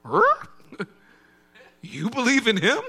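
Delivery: high, startled "Huh?" with a laugh; mock disbelief. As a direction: squeak out disbelief, half laughing. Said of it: "you listen to the album and you say".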